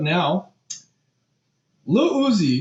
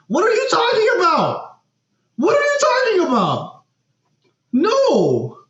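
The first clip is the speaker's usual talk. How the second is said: very high-pitched incredulous cry; high, exasperated protest; incredulous outburst. Real, not acted. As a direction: squeal it out in total disbelief. Mock outrage at [name]'s statement.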